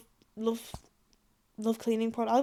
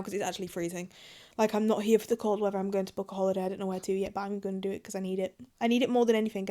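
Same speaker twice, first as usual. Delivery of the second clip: ordinary talk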